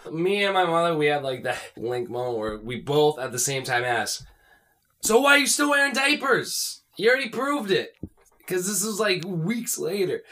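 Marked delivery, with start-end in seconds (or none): 5.0-7.9 high-pitched retold challenge; mock incredulous challenge. Imitating himself and mother questioning the friend, high incredulous challenge.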